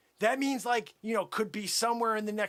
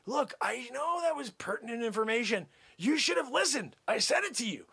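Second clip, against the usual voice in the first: ordinary talk, nothing marked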